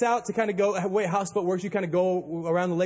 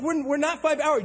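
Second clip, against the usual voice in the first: high, insistent protest; insistent objection; 7 semitones higher than usual. Retold or imitated. Captain insists to the stranger on the radio, protesting. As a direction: refuse to accept it. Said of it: "I'm like".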